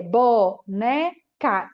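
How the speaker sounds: slow BO... NE... CA with gaps; one syllable at a time. Shown as a demonstration. Teacher calling syllables as child hops hoops, slow and separated. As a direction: give each syllable of BONECA its own long beat. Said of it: "A cada vez que eu falo uma sílaba".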